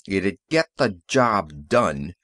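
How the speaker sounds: slow, firm, low indignation; mild indignation; indignant. A narrator's reading. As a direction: lay each word down, offended. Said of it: "said Fitzgerald indignantly".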